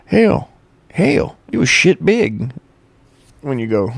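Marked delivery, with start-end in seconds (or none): 0.0-2.5 high, loud hillbilly drawl. Pretend. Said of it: "I sound like a redneck".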